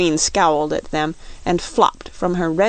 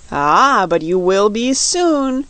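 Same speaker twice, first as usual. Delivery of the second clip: slow, louder retort with relish; grim retort; 3 semitones higher, 5 dB louder, slower than usual. A narrator's reading. Queen promising captives they will be soup, grim slow retort. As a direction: draw it out through a grim smile. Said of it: "retorted the Queen, a grim smile".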